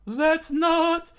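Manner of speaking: loud, high falsetto; hammed-up high protest; startled retort. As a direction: squawk in cartoon disbelief. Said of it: "looked up and said".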